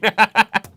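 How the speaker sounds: big surprised laugh; loud laugh. Real, not acted. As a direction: burst out laughing. Laughs loudly.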